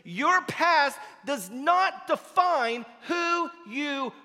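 loud, rising preacher's call; preaching at full force. Acted. Pastor urging the congregation, preaching at full force. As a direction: drive it home from the pulpit.

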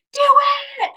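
very high squealed cheer; cheering crowd. Acted. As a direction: cheer them on like fans in heaven. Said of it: "people are cheering".

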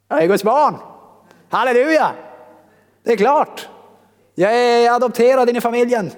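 high, loud celebration; jubilant shout. Acted. Preacher declaring adoption into God's family, jubilant shout. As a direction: shout it out like a victory.

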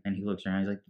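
usual speaking voice

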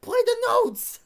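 incredulous, high, building to a loud shout; mock furious yell. Fletcher scolds Neiman for singing the notes, mock yelling. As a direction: explode like an exasperated teacher. Retold teacher blowing up at student.

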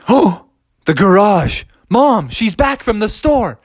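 lightly voiced alarmed kid; gasp, then a worried realization; Froggy. Boy hearing Mom come home, mildly alarmed. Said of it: "And Froggy's like".

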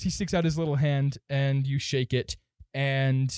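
normal talking voice

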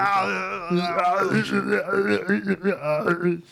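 drawn-out wordless yowling impression; Bobcat Goldthwait. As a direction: imitate a comedian's wobbly, strangled noises. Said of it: "That was Bobcat Goldthwait right there".

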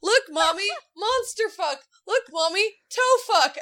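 put-on high, loud kid cheer of monster fuck; excited toddler. Mom imitating her son cheering over his monster truck, high and loud. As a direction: cheer like a thrilled little boy. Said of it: "son's listing quoted".

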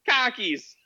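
high, loud mock Boston "Khakis"; hammed-up Boston outburst; Boston accent meme. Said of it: "Yeah, it's Boston".

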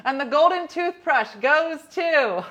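hammed-up awards host reading nominees; mock Oscars presenter; awards announcer.

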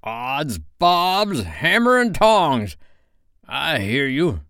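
high, drawn-out pirate captain mimicry; mock the captain with a ringing oath; slow, blustering pirate voice; Hook. A narrator's reading. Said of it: "answered in Hook's voice".

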